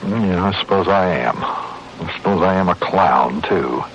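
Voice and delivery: low, slow, sinking admission; dejected. Acted. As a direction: let the voice droop in defeat. Dejected admission after forced laughter.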